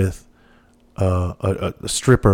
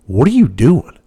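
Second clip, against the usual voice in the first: pissed officer; 4 semitones higher, 5 dB louder than usual. Retold or imitated. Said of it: "And he was pissed".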